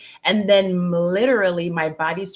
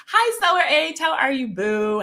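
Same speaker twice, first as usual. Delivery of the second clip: bright greeting; 10 semitones higher, 3 dB louder than usual. Real, not acted. Greeting a chat viewer.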